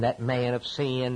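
ordinary talk

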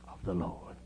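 breathy whisper of the last words; whispered echo. Acted. Preacher softly echoing Jonah's cry. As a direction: let it fall to a whisper. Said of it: "whispered echo after the cry".

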